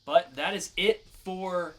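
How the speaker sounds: loud, upbeat announcer sign-off; podcast host wrapping up the news; segment sign-off. Acted.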